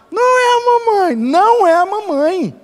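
put-on high squeaky baby voice, repeated; Baby Sinclair imitation. Preacher imitating the cartoon baby, squeaky high.

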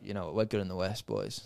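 a lazy, muttered brag, dropping low; mumbled low brag; mock brag, low and soft. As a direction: throw it away low and quiet, mocking. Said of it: "Anthony Davis was like".

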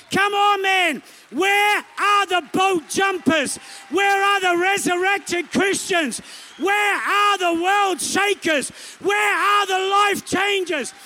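high, slow, shouted challenge; impassioned preaching shout. Real, not acted. Preacher shouting a challenge to the church. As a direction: cry it out to the congregation, slowly. Preacher's rising appeal to crowd.

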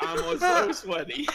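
squeaky, laughing high-pitched complaint; high mock-whiny sweaty complaint; high laughing whine. Real, not acted. Mock whining about sweating.

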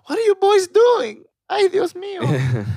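high startled mom; hammed-up mother shriek; shrill mom voice. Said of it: "somebody's mom comes in".

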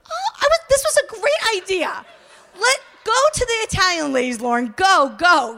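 high, excited retold egging-on; eager urging. Nervous newcomer sending Lauren to the Italian ladies, eager. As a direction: push your friend forward with comic enthusiasm. Eagerly sends friend up first.

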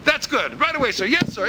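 high, fawning sycophant voice; simpering toady chorus; mock yes-man. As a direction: flatter the boss like a hired yes-man. Mocking yes-men flattering the boss.

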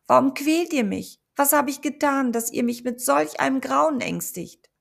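loud, high quavering call; trembling cry. A narrator's reading. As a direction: cry out shaking with fear. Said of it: "rief mit zitternder Stimme".

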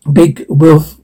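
ordinary delivery

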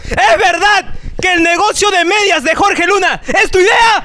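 theatrical high-voiced reveal; dramatic question. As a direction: announce it as a scandal, climbing at the end. Reads out a scandalous question.

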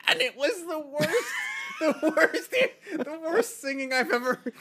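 high-pitched words breaking into laughter; can barely get it out for laughing. Real, not acted. Laughing at a terrible singer.

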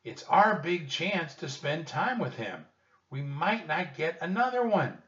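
put-on high, loud, excited girl character; eager Annie. A narrator's reading. Annie pressing Jack not to miss their big chance, excited.

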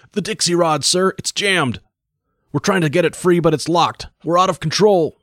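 high, loud terrified report; shrill terror. Acted. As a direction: scream it into the audio in terror. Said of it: "shrill with terror".

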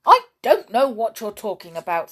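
loud, indignant denial; angry. A narrator's reading. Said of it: "said Perry angrily".

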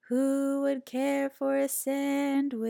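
slow sing-song call. A narrator's reading. Hidden creature calling to Pinkerton. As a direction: tempt him slowly.

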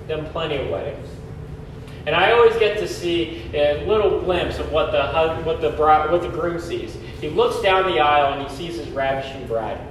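usual speaking voice

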